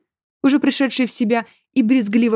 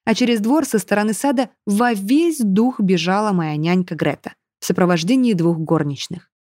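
usual speaking voice

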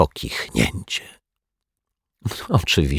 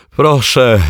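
called out louder, a touch higher; 6 semitones higher, 6 dB louder than usual. A narrator's reading. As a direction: call through the door to come in. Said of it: "zawołał detektyw Pozytywka".

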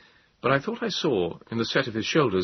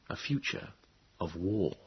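hushed; 9 dB quieter than usual. A narrator's reading. Grave aside to Moses.

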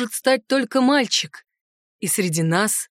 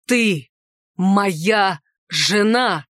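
"Ты", "моя", "жена" drawn out and set apart; pressing, emphatic; 3 semitones lower, much slower than usual. A narrator's reading. Centaur claims her as his wife, "Ты моя жена" pressed word by word. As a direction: lean hard on "Ты моя жена", each word slow and separate. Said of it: "с нажимом произнёс он".

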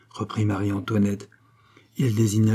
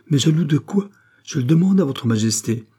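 high, louder protest; exclaiming; 6 semitones higher, 4 dB louder than usual. A narrator's reading. Blushing Charny protests to the queen, exclaiming. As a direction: cry out in flustered challenge. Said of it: "s'écria de Charny rougissant".

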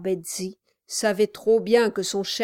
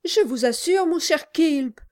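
trembling; 8 semitones higher, 5 dB louder than usual. Acted. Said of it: "répliqua sa femme en tremblant".

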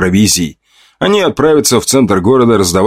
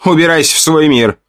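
higher, lightly voiced shout; 8 semitones higher than usual. A narrator's reading. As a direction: order him out with force. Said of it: "громко крикнула она".

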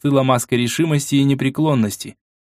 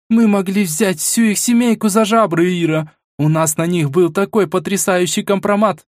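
frustrated woman; 8 semitones higher than usual. Acted. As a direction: voice the frustrated agent. Vasilisa voiced, frustrated.

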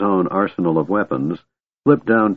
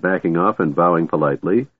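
ordinary delivery

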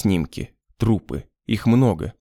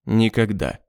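cold refusal. Acted. Beda's flat refusal.

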